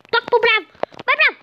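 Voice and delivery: usual speaking voice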